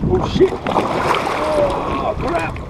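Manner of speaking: sudden high yelp of alarm; startled. Real, not acted. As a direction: caught off guard by a big wave. Big wash hits the kayak.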